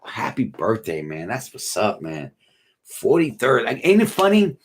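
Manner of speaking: ordinary talk, nothing marked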